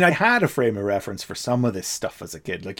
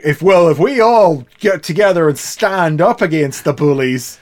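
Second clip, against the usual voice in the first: mocking preachy; 6 semitones higher, 8 dB louder than usual. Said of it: "the end point is like".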